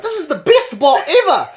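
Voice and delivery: high, loud gushing quote; excited exclaim. Retold or imitated. Friend gushing about the dodgy bar, excited. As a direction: squeal it like it's the best place ever.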